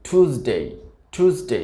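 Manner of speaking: American টিউসডে as "TOOS-day"; plain "too" opening টিউসডে; American pronunciation. Shown as a demonstration. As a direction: say টিউসডে with no y glide after t. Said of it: "আমেরিকানরা এটিকে উচ্চারণ করে থাকে".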